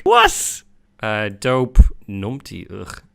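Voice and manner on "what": high, loud shriek of disbelief; baffled outburst. Real, not acted. Quiz taker hits an unknown slang word, baffled shriek. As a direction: yelp it in bafflement.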